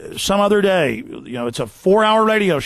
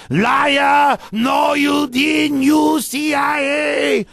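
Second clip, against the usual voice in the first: imitated screaming; 9 semitones higher, much slower than usual. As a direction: yell it like a raving heckler, dragging out the end. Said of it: "they would start screaming and spitting".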